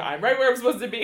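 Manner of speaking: high, loud, fast, breaking into laughter; retold overwrought outburst. Laughing over her bawling at the show, overwrought. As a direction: gush it, half-laughing at yourself. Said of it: "I just start bawling".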